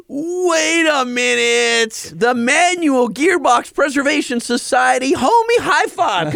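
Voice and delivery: high, slow, thrilled disbelief; gasping with delight; excited. Real, not acted. Excited at the T-shirt reveal.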